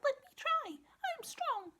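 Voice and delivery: very high, squeaky little voice; tiny mouse voice. Acted. The little mouse offering to help, very high.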